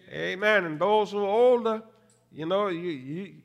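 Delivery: normal talking voice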